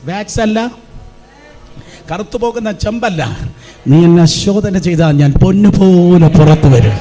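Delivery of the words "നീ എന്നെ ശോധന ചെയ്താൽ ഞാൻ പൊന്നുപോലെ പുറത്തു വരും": booming preached declaration over the music; loud, triumphant preacher's cry; loud proclamation. Real, not acted. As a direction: proclaim it full voice to the church.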